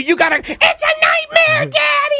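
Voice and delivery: put-on very high falsetto kid wail; hammed-up high-pitched scream; child yelling. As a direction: imitate a shrieking child in a panic. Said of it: "I got her yelling".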